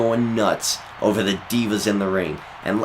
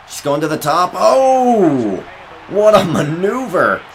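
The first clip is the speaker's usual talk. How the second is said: high, loud ringside shout; excited commentator; 6 semitones higher, 4 dB louder than usual. Acted. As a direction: sell the big move to the crowd. Big move and near fall.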